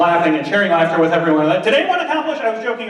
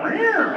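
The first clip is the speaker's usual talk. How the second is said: high cat yowl, falling at the end; imitated high-pitched meow; cat sound. Shown as a demonstration. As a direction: screech like an annoyed cat. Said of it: "the meaning of that".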